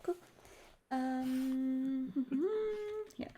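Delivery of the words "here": usual speaking voice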